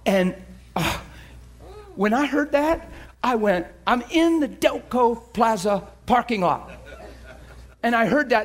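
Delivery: ordinary talk, nothing marked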